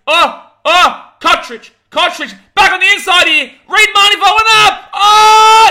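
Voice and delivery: high, loud frantic play-by-play; excited shouting. Real, not acted. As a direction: yell as the break unfolds. Live play, near try excitement.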